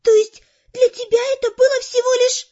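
high-pitched hurt outrage; indignant. A narrator's reading. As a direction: protest in a high girl's voice. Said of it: "возмущённо воскликнула магичка". (возмущённо и высоко, с обидой)

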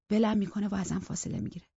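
ordinary delivery

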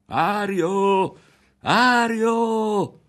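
high, loud woman's call from afar; woman calling loudly. A narrator's reading. A woman calls Ario back from a distance, loudly. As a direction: call the boy back sharply. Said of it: "una voce di donna, forte".

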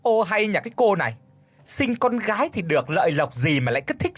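high, scornful and nagging; mother-in-law mocking her tears, scornful; sneering mother-in-law. A narrator's reading. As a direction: sneer down at the crying girl. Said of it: "nghiến răng đáp".

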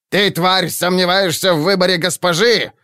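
sharp high angry bark; barking at her. A narrator's reading. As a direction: snap at her like a harsh witch. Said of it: "рявкнула на неё Гитлана". (рявкнуть зло)

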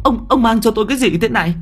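high, fast frightened shriek; old woman panicking at the pigs; terrified. A narrator's reading. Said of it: "Bà cụ sợ quá".

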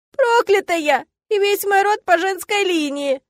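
high, loud lament; despairing, stretched outburst; wailing revelation. A narrator's reading. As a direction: cry out the family curse. Heroine cries out her curse.

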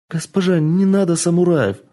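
put-on high, begging girl's voice; pleading. Kitsune begging the madam not to call samurai, high pleading. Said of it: "взмолилась Кицуне".

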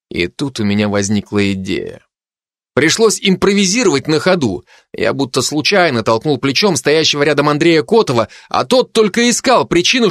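ordinary delivery, nothing marked

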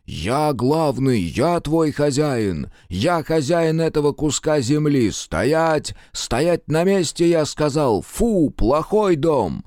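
slow, high, commanding yell; shouting. A narrator's reading. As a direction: bellow orders at the house. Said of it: "и орал".

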